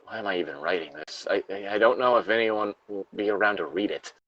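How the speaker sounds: a lightly acted trapped survivor, reading steadily; reading a Fallout lore log aloud to a friend, somber. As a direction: read the survivor's diary entry gravely. Reads the log, despairing.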